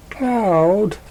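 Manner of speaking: calling his name high across a distance; high call after a friend. Acted. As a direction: shout to stop him leaving. She catches up calling.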